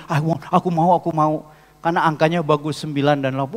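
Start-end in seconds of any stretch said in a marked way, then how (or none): 0.1-1.5 loud, urgent repeated plea; mock impatient eagerness; eager buyer. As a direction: imitate someone itching to buy. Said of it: "Pengen cepet beli".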